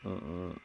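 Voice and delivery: low, very soft throat rattle; quiet demonstrative croak; voiceless throat rasp. Shown as a demonstration. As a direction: let only the rough throat noise out, no voice. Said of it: "これ声を出さないと".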